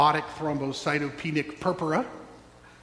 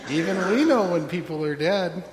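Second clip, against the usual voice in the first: mocking wailers. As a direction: scoff at the fool doctor. Mourners mock Jesus, laughing.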